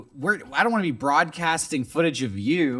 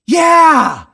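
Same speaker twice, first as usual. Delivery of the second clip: hype shout; 9 semitones higher, 9 dB louder, slower than usual. Real, not acted.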